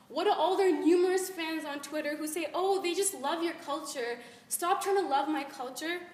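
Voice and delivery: loud, high, defiant challenge; indignant spoken word. Acted. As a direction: confront the fans and tell them to back off.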